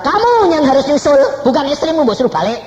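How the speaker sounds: high, loud booming rebuke; God speaking. Retold or imitated. God scolding the father, high and loud. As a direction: thunder it down as God. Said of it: "Lalu Tuhan berkata".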